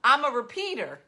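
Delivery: emphatic confession. Real, not acted. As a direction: proclaim it word by word like a confession. Loud emphatic confession of repeating.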